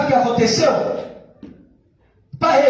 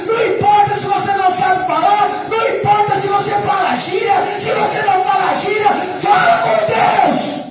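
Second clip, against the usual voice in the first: preaching at full force; 4 semitones higher than usual. Acted. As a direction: build to a peak on fala com Deus. Preaching building to climax. (pregação inflamada, crescendo)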